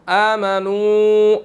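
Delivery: आमनु with the final u held for a full second; long madd. Shown as a demonstration. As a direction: stretch the u of आमनु one count. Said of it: "one second लंबा करना है". (आमनु का उ एक second खींचें)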